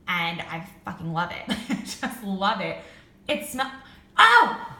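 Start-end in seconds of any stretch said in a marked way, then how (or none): 4.2-4.7 high, loud sudden oh; sudden realization. Real, not acted. Realizing what the candle smells like, surprised oh. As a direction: it just clicked, blurt it out.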